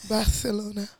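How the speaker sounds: "Barcelona" with the c as th; Castilian lisp on the c of "Barcelona"; lisped Spanish pronunciation. Shown as a demonstration. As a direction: say "Barcelona" like Bar-the-lona. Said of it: "that's how you say it".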